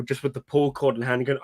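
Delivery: ordinary delivery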